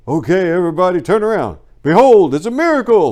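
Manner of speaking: high, loud showman's cry; hammed-up miracle announcement. Performing a South Park skit at the yeshiva, loud and high. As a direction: belt it out like a stage miracle. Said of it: "thanks to South Park for the".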